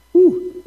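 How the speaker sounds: quick high-pitched whoop. Acted. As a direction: yelp like a ghost. Playful whoop after line.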